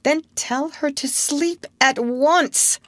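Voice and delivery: high, slow, insistent demand; impatient child's command, spelled out slowly; almost ordering. A narrator's reading. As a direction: press it as a near-order. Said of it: "John almost ordered".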